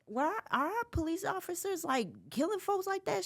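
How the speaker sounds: high, softly retold question; incredulous question to mom. Retells asking mother a worried question.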